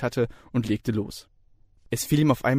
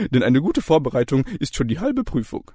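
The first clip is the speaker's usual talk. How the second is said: jovial lecturer; 3 semitones higher, 4 dB louder than usual. A narrator's reading. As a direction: chirp it brightly. Ossip proverb.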